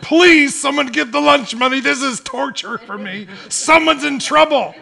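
loud, high-pitched begging; mock desperate plea. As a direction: wail it like a tormented schoolkid, hammed up. Acting out tortured schoolkid begging.

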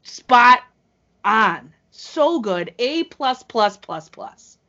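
emphatic, loud rave with a gap before the last word; gushing verdict, hit hard; emphatic praise. Real, not acted.

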